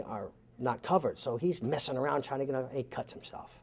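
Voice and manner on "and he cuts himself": voiceless whisper of and he cuts himself; whispered aside. Retold or imitated. Telling how the passenger cut himself on the belt: whispered aside. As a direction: drop to a breathy whisper for the aside.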